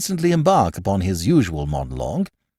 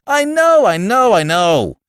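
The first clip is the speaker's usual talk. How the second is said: loud, much higher, exasperated outburst; irritable; 11 semitones higher, 6 dB louder, slower than usual. A narrator's reading. Bill cutting off Archie, loud and irritable. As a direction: cut him off in irritation. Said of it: "said Bill irritably".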